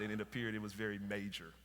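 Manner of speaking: normal talking voice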